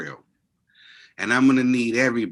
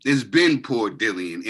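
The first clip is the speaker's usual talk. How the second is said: ordinary talk, nothing marked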